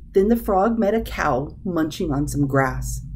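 ordinary delivery, nothing marked

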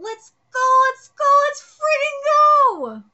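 high, loud rallying cry; loud, high, slow-building chant of let's go; hyped shout. Acted. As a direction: fire up the whole team. Character hypes up the team.

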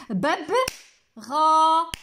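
ببغاء broken up, long held غا; very slow syllable-by-syllable demonstration; syllables with claps. Shown as a demonstration. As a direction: pause between syllables as if clapping them. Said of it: "غادي نحاول ندير لكم تصفيق".

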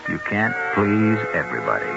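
usual speaking voice

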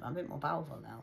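normal talking voice

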